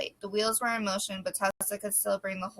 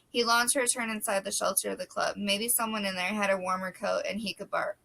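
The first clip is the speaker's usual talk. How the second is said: ordinary delivery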